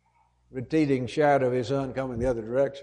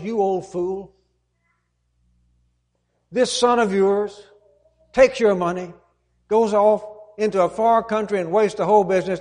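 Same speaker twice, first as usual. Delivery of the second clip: louder, scornful complaint to the father; contemptuous son; 5 semitones higher, 5 dB louder than usual. Retold or imitated. The older son scorns his forgiving father, contemptuous. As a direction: spit the words at the old man. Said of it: "his attitude toward his father is".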